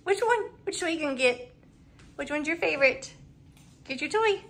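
high, sing-song voice for the dog; pet talk. Real, not acted. Talking to her dog Bailey.

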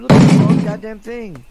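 loud frustrated yell at a friend; exasperated shout. Real, not acted. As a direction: shout it, fed up. Friend fumbling with item.

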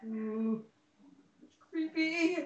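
usual speaking voice

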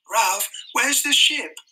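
put-on high, slow, worried boy; anxious boy voice. A narrator's reading. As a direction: ask slowly, uneasy. Piggy asks anxiously.

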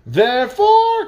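high, loud, slow therefore; Duke concludes. A narrator's reading. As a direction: announce it grandly. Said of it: "concluded the Duke".

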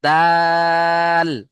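দাল held three alif lengths, loud; recitation-style long vowel; long drawn daal. Shown as a demonstration. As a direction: stretch দাল long and full. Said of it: "তিন আলিফ টান দিতে হবে".

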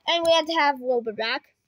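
ordinary talk

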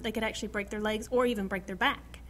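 usual speaking voice

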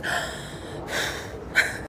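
long, soft breathy sigh; heavy sigh. Real, not acted. Vlogger overwhelmed by what was said about her, heavy sigh. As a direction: let out a long, quiet breath. Overwhelmed after hearing the mocking audio.

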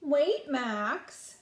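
lightly voiced bossy big sister; big sister Ruby. A narrator's reading. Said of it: "said Max's sister Ruby".

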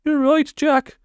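very high, squawky villager; hammed-up shrill panic; panicked shriek. A narrator's reading. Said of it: "shrieked another".

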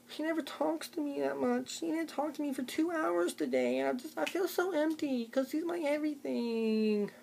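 put-on high, soft, slow whining girlfriend; whiny girlfriend. Mocking a girlfriend whining her boyfriend stopped texting. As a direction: pout about the silent boyfriend. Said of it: "When you like it, like".

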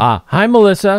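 AA group reply. Pretend. Cohost answering her mock AA meeting intro, hammed-up group greeting. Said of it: "You're supposed to say".

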